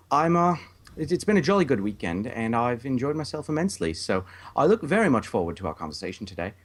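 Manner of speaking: a try at a jolly British gent, lifted in pitch; an amateur, slipping English accent answering the host. Pretend. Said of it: "It started out wonderful, but you lost it, and then it picked back up".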